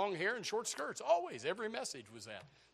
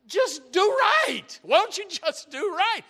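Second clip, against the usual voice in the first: exasperated scolding; 10 semitones higher, 11 dB louder than usual. Retold or imitated. Couple's silent scolding voiced.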